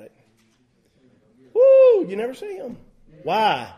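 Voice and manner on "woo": high, loud whoop of delight; joyful whoop. Real, not acted. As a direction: let out a happy woo. Joyful preacher's exclamation.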